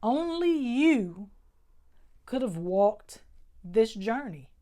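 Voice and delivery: normal talking voice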